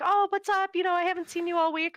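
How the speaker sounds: high, loud excited chat greeting. Retold or imitated. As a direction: gush like an eager friend. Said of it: "they're just like".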